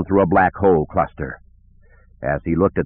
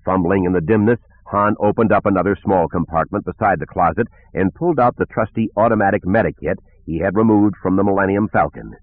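ordinary delivery, nothing marked

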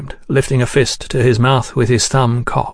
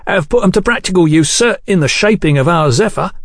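higher, quick and proud; 6 semitones higher than usual. A narrator's reading. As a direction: boast of the workshop's handiwork. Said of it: "said Mister Chesterton proudly".

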